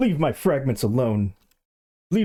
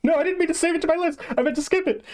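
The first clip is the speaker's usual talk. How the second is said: much higher, frantic squealing protest; panicked squeal. Real, not acted. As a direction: shriek it out in real panic, high and fast. Accidentally saved video, panicking.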